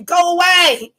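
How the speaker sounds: reenacted exasperated dismissal; retold shooing away. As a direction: shoo someone off loudly. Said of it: "I'm like".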